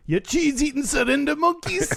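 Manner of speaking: put-on Scottish accent. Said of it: "I only know the".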